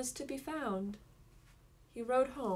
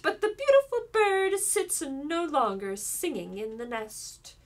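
mocking; 8 dB louder than usual. A narrator's reading. Enchantress taunts the king's son at the window, loud mocking. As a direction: taunt him, savouring every word. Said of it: "she cried mockingly".

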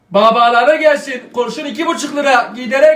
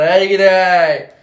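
very slow, low drawn-out hey gidey; nostalgic sigh; 5 semitones lower, 4 dB louder, much slower than usual. Acted. Father sighs wistfully about the old days.

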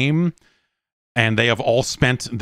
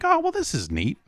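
much higher, softer, gushing fan; mock delight; 5 dB quieter than usual. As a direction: squeal it like a thrilled fan. Fan's delighted reaction acted.

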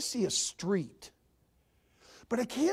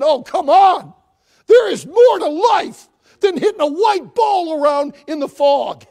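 louder, protesting shout; shouted exhortation; 6 semitones higher, 17 dB louder than usual. Acted. Preacher shouting to the congregation that life holds more. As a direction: throw it out to the back row.

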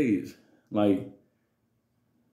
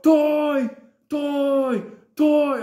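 high, loud drawn-out bellow; high hollered demand, stretched out; imitated screaming; 11 semitones higher, 5 dB louder, much slower than usual. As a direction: mimic someone screaming for a toy. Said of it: "this motherfucker screaming".

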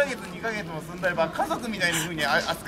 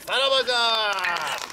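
drawn-out farewell call; 4 semitones higher, 3 dB louder, slower than usual. Acted. Samurai calls farewell to crowd.

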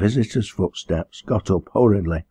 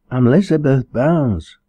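put-on high-pitched young woman's voice, louder; timid girl; 7 semitones higher, 5 dB louder than usual. Girl giving the minister her name, timidly. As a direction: answer up high, like a nervous girl.

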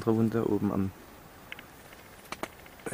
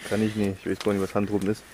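normal talking voice

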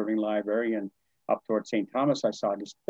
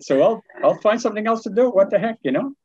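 much higher, louder, brushing it off; defiant retort; 11 semitones higher, 6 dB louder than usual. Retold or imitated. Teacher telling off the principal, loud and defiant. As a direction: toss it back defiantly.

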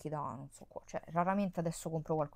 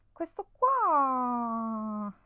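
very slow, higher and louder, drawn out; excited presentation; 6 semitones higher, 9 dB louder, much slower than usual. Real, not acted. Vlogger showing a lip product, drawn-out excited reveal. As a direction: savour the reveal.

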